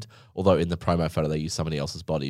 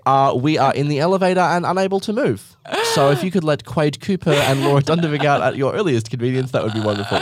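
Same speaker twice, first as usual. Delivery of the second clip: overly polite announcement; 7 semitones higher, 4 dB louder than usual. Retold or imitated. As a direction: read it out like a very proper help call with a laugh in it. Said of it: "Whereas you'd be like".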